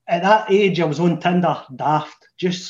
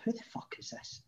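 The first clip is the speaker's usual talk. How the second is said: whispered, higher and much softer; whispered inner thought; 6 semitones higher, 20 dB quieter than usual. Retold or imitated. Seeing a stranger stare at him, startled whisper.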